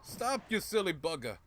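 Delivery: high, hammed-up British yell; British accent. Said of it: "randomly giving British accents".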